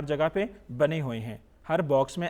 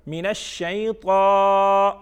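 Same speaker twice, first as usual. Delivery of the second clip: slow, louder recitation with long held vowels; model recitation; 6 dB louder, much slower than usual. Shown as a demonstration. Teacher models the third part for children, slow recitation. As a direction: chant it out for learners to follow.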